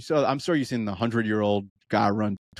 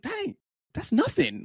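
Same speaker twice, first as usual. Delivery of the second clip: incredulous. Retold or imitated. Mocking a runner's short plan.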